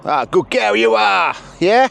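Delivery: high, loud delighted praise; excited praise. Real, not acted. Trainer praising the sheepdog after a good run. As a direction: cheer the dog in.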